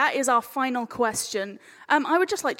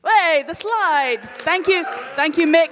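relieved call-out; 5 semitones higher, 9 dB louder than usual. Real, not acted. Slide finally appears, calling out.

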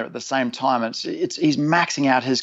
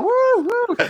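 much higher, gleeful mock whooping; excited whoops. Mate hauling the rope whoops at the storyteller, high and gleeful. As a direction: imitate a buddy whooping as he hauls in a fish. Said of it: "looking at me going".

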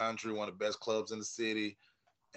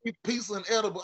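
mock complaint; 9 semitones higher, faster than usual. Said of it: "I'm like".

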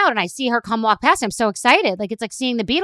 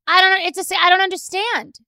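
baffled; 9 semitones higher, 4 dB louder than usual. Real, not acted. Keeps up the baffled disbelief.

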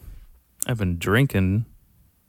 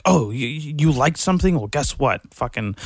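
mock high school bully; 6 semitones higher than usual. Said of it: "just like".

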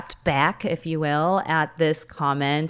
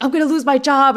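panicked near tears; 8 semitones higher, 5 dB louder than usual. Retold or imitated. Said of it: "And I almost started to cry".

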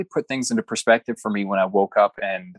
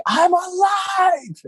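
drawn-out, much higher shout of joy; joyful yell; much slower than usual. Retold or imitated. Man waking in hospital shouting with joy, slow and much higher. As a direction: cry it out like you just came back to life. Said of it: "wanted to yell it at the top of my lungs".

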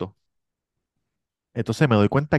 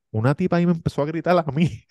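put-on upset girlfriend; 8 semitones higher, faster than usual.

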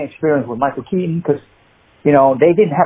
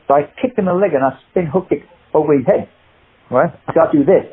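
normal talking voice

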